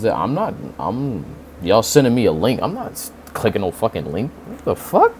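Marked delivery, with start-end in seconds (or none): 4.5-5.1 shocked. Real, not acted. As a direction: pitch jumps up in disbelief. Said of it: "that just happened in real time".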